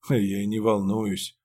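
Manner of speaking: usual speaking voice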